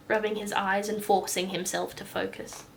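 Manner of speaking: plain reading voice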